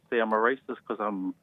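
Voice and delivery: ordinary talk, nothing marked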